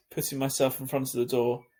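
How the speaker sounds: usual speaking voice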